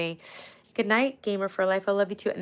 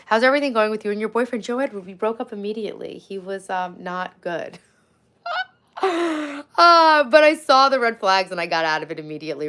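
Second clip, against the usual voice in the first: ordinary talk, nothing marked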